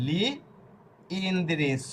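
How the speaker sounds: teacher's deliberate two-part লি ... ইন্দ্রিস; pronunciation demo. Shown as a demonstration. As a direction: sound out "লি ইন্দ্রিস" with an I after the L. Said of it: "এখানে ই অক্ষর নিয়ে আসব".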